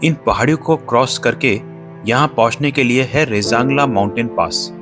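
usual speaking voice

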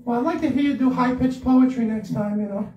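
high, sweet angel voice; little angel voice. Real, not acted. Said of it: "with my voice like this all the time".

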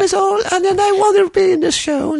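very high mock British accent; squeaky, hammed-up British wannabe actor. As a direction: imitate a Brit in a high put-on voice. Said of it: "Somebody from the U K".

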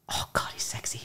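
hushed, whispered aside; whispered swoon. Real, not acted. Fan confessing her idol is attractive, swooning whisper. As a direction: whisper it like a guilty crush.

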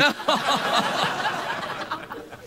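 airy laugh way up high; high squealing laugh. Real, not acted. Cracking up at the British bit, a squealing laugh.

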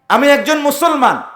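high, loud, wholehearted declaration; gladly declaring. Acted. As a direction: proclaim it openly from the heart. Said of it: "খুশি মনে, আনন্দিত চিত্তে". (খুশি মনে উঁচু গলায় ঘোষণা)

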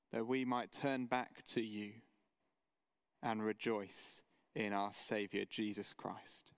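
reverent pastor's prayer. Acted. Opening prayer before sermon.

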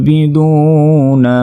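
slow tilawat; Quran recitation. Shown as a demonstration. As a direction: recite আবিদুনা melodically. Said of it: "নুন জবর না".

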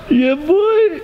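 high, loud whoop; excited yell. Real, not acted. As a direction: yell it out in triumph. Cheer as the jump happens.

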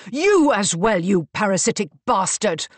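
loud, furious insult; angry shout. A narrator's reading. Marcus rounds on Mister Davenport, angrily shouting. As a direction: hurl it at him, loud.

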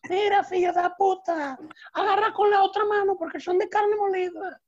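put-on high Portuguese-accented child's punchline. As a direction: deliver it like a Portuguese kid. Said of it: "el Jaimito portugués". (niño con acento portugués, agudo)

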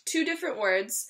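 ordinary delivery

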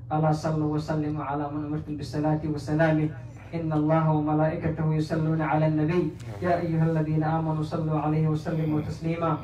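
low, quick, even Arabic recitation; recited salawat. Real, not acted. Preacher calling blessings on the Prophet, rapid low recitation. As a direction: run through the blessing in a low formal voice.

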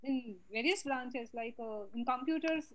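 very faint, distant voice; much too soft to carry; barely audible. Real, not acted. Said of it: "Again, very low".

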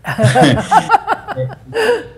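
a high, bright burst of laughter; big laugh. Real, not acted. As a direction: let out a real laugh. Risa tras contar la historia. (carcajada aguda y breve)

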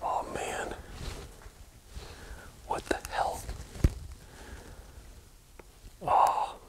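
tense hushed whisper. Real, not acted. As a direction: whisper so nobody hears you. Hears noise in dark basement.

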